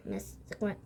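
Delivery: ordinary delivery, nothing marked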